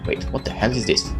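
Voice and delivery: usual speaking voice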